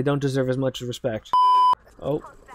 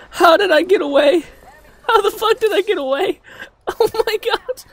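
high, squealing relief and panic; panicked disbelief; 6 dB louder than usual. Real, not acted. Gamer amazed to have got away, high panicked squeal. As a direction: squeal it, still badly rattled. Just escaped the attack.

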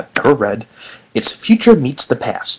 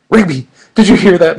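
alarmed Mordecai; 5 semitones higher, 8 dB louder than usual. A narrator's reading.

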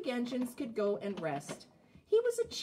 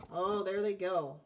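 usual speaking voice

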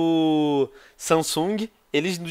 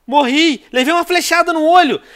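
dying shout; 11 semitones higher, 7 dB louder than usual. Real, not acted. Shark dies in game.

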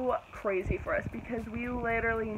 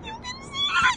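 excited shout. Real, not acted.

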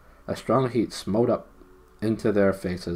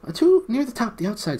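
high, louder shuddering gnome; 4 dB louder than usual. Acted. Gnome shuddering at the thought of the surface. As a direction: shudder through the words. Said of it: "said Gold, shuddering".

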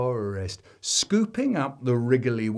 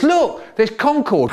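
excited brother; 9 semitones higher, 7 dB louder than usual. Retold or imitated. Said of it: "My brother says".

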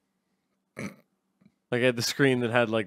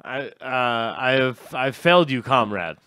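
apologetic mock-Russian accent; 4 dB louder than usual. Producer apologizing to his comrade host for spoiling the bit, Russian accent. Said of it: "we do accents for whole first bit".

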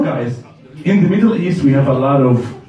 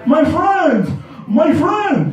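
street seller shouting; 12 semitones higher, slower than usual. Retold or imitated. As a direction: yell across the street to grab him.

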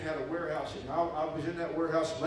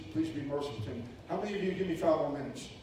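ordinary talk, nothing marked

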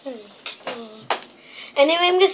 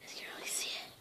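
whispered showing; 21 dB quieter than usual. Real, not acted. Vlogger whispering while showing her painted initials. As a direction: murmur it close to the camera.

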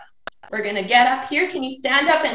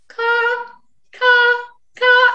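high, growing louder crow caws; 8 semitones higher, 4 dB louder, much slower than usual. Acted. Teacher cawing like a crow for children, high and rising in volume. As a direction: caw like a crow for the kids.

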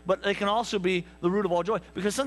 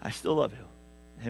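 tender; 8 semitones lower, 3 dB quieter, slower than usual. Acted. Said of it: "my wife is gonna go".

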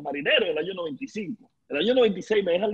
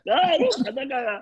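laughing; 6 semitones higher than usual. Retold or imitated. Laughing retort.

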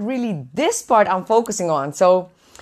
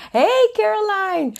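high bright hello to a viewer; excited greeting; 11 semitones higher than usual. Real, not acted. Greeting a live stream viewer, high and excited. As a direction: call out a viewer's name, pitched way up.

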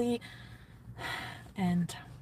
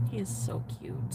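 soft dreamy sigh; 9 dB quieter than usual. Real, not acted. Repeated softer, dreamy.

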